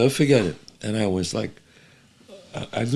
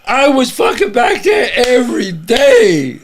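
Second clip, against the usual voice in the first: excited shout; 9 semitones higher, 6 dB louder, slower than usual. Real, not acted. Excited boast about going back.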